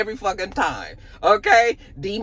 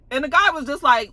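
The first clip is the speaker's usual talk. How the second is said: ordinary delivery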